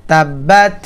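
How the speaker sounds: slow chanted line to repeat; repeat recitation. Shown as a demonstration. Teacher reciting again for students to repeat. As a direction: recite it again for them to echo. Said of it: "আবার বলুন".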